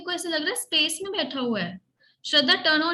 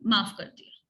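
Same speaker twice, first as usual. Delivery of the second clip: low, gentle forgiving; 6 semitones lower than usual. Shown as a demonstration. Teacher models the phrase.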